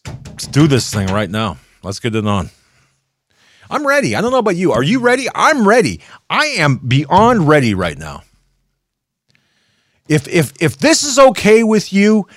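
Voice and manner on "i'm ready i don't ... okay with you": high, louder shouted taunt; ready to rumble, yelling it; fired-up challenge. Real, not acted. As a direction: call them out, much higher and louder. Challenging someone to fight.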